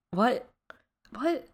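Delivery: rising incredulous what; high, baffled what. Acted. Mock incredulous reaction.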